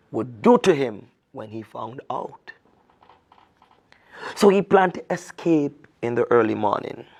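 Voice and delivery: lively storyteller reading a folk tale; storyteller voice reading to children; story narration. A narrator's reading. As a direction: tell it like a children's story, expressive and clear. Reads the folk tale aloud.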